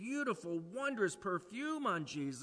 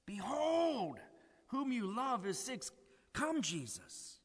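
pleading sisters; 6 semitones higher than usual. Retold or imitated. Sisters' plea voiced.

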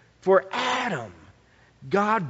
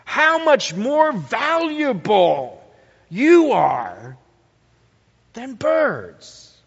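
slow, high and loud on "much more valuable"; stressed comparison; 7 semitones higher, 7 dB louder, slower than usual. Shown as a demonstration. Preacher showing the Greek emphasis, slow and loud. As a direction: draw out each word of the comparison. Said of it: "The emphasis of these Greek words".

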